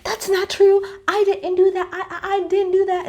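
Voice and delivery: high, defensive mock protest; snapping Jen. Mimicking Jen as she snaps back at Meredith, high and defensive. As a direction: squeak out a frantic denial. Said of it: "Jen snaps and she's like".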